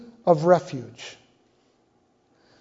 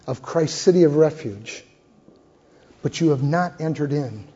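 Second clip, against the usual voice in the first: earnest appeal; 3 semitones lower, 5 dB quieter than usual. Acted. Closing altar appeal.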